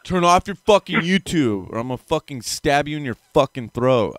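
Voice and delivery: angry yelling. Real, not acted. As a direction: snap at the viewers to shut it off. Yelling at chat to stop YouTube.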